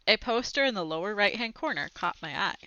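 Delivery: ordinary delivery, nothing marked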